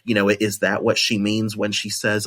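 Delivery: usual speaking voice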